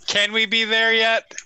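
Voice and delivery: put-on whiny impatient child; whiny kid voice. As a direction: nag like a bored kid in the back seat. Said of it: "that was in character".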